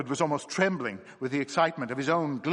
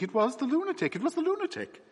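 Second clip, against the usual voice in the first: rising higher on the repeat; worn-out insistence climbing in pitch; wild fatigue; faster than usual. Acted. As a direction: insist again, higher and more frantic. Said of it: "with a sort of wild fatigue".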